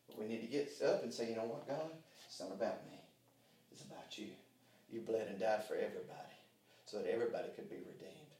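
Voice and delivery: soft, low intimate prayer, almost breathed; hushed prayer. Shown as a demonstration. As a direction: pray it quietly, near a whisper. Quiet closing prayer.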